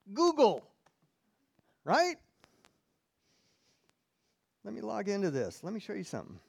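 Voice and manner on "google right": playful exclaim. Real, not acted. Names his ungodly source.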